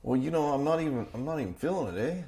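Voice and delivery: high, swinging mock-Canadian accent; lilting Canadian caricature with a tagged-on eh; Canadian impression. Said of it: "He goes".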